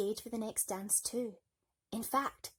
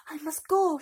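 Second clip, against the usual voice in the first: alarmed cry; 8 semitones higher, 10 dB louder than usual. Acted. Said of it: "she cried in alarm".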